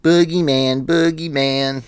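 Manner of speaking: high-pitched mocking chant; sing-song playground jeer, pitched high; taunting schoolyard bully. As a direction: imitate kids teasing a smaller boy. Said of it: "are bullying Tommy Doyle".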